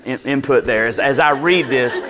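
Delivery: ordinary talk, nothing marked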